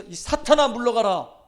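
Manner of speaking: loud, high cry of command; sharp rebuke. Acted. Jesus naming Peter Satan, loud sharp rebuke. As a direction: point straight at him and order him away. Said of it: "베드로를 딱 지칭해서".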